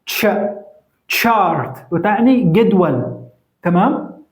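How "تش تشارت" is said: "تش-، تشارت" with ت and ش blended as one "ch"; ch sound demonstrated. Shown as a demonstration. Teacher showing how two letters sound together, "تشارت" opening on a ch sound. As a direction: say "تش-" alone, then "تشارت" starting with that ch. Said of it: "تنطق تاء وشين".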